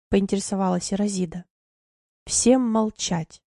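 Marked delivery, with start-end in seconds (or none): 2.3-3.4 slow, drawn-out order from afar; slow distant command. Retold or imitated. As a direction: hold each word like a distant command. Overheard order to the council.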